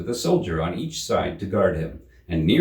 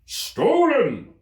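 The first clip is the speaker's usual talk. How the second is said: much higher, louder outraged cry; exclaiming; 6 dB louder than usual. A narrator's reading. King accusing the Hatter, high loud exclamation. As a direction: blurt it out, scandalised. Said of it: "the king exclaimed".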